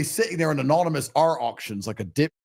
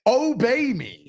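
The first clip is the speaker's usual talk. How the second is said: slow, higher, mock-domineering command; hammed-up tyrant dad giving orders; commanding father; 8 semitones higher, much slower than usual. Acting stern father to kids.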